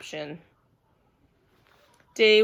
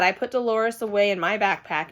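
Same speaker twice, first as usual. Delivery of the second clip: ordinary delivery, nothing marked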